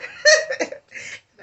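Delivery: high, loud, breathy burst of laughter; squealing laugh of joy; delighted laugh. Real, not acted. Laughs at the memory.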